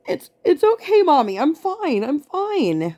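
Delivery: very high, tearful little-kid imitation; squeaky mock-brave voice, close to crying; holding back tears. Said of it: "holding back tears".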